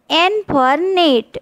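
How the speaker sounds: put-on very slow, each word spelled out for kids; slow classroom model reading; slow demo reading. Shown as a demonstration. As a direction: read it slowly so children can copy. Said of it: "ઉચ્ચાર શીખીશું".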